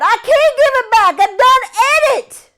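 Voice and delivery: very high, loud panicked denial; terrified protest. Acted. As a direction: plead in fear. Jake panicked confession.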